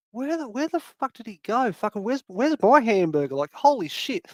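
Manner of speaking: high-pitched, baffled complaint; exasperated disbelief. Retold or imitated. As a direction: demand to know where he went, pitched right up. Baffled at friend vanishing with order.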